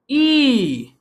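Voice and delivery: ई held long, falling away; E read as ई. Shown as a demonstration. Teacher showing the other reading, long held ई. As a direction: stretch out a long ई. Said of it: "कभी कभी पढ़ेंगे".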